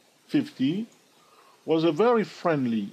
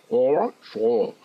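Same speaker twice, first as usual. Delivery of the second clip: put-on American accent; 5 dB louder, slower than usual. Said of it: "my American accent".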